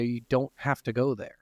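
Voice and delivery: normal talking voice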